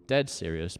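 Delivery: usual speaking voice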